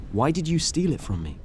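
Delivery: usual speaking voice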